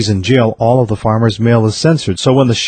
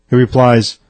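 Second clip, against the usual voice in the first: plain reading voice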